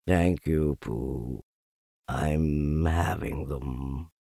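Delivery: low, soft, dragging donkey voice; gloomy Eeyore. A narrator's reading. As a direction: drag it out low, deep in gloom. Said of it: "said Eeyore gloomily".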